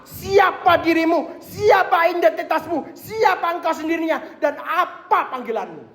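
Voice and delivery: high, slow, ringing call; fervent preaching. Acted. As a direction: proclaim each "siapa" to the congregation. Preaching climax.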